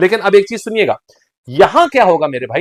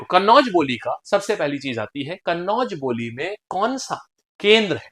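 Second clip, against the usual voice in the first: normal talking voice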